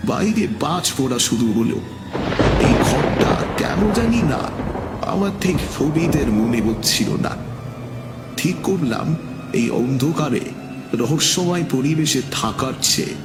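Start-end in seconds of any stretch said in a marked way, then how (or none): none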